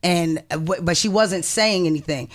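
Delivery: usual speaking voice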